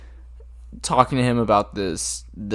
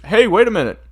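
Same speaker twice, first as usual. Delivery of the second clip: much higher and louder, calling out; mock shout; 14 semitones higher, 13 dB louder than usual. Imitating guards shouting at the hitman to stop. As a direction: yell after someone leaving. Quoting people stopping the hitman.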